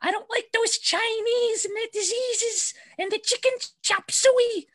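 hammed-up old woman who lost her mind; senile great-grandmother. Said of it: "the quote that gets passed around is".

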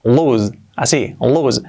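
"lose" slow and low as /luz/; "lose" repeated slowly, long u and a z sound; slow model pronunciation. Shown as a demonstration. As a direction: drill "lose" slowly as looz. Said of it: "la pronunciación es lose".

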